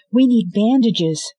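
high, loud, pressing; urgent plea. Acted. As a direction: demand help now. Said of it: "said Scarlet".

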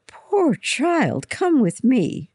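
shocked, urgent concern; gasped. A narrator's reading. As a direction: exclaim in alarmed sympathy, pitch leaping. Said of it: "Then she gasped".

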